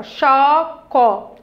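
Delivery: slow, clearly separated syllables. Shown as a demonstration. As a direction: open the mouth for each of four syllables. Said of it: "eu abri minha boca quatro vezes".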